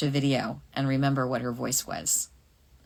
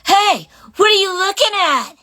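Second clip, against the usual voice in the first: put-on much higher, louder, mad fighter girl; Juri Han; 11 dB louder than usual. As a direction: snap at the player, high and loud. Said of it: "she broke the fourth wall and she says".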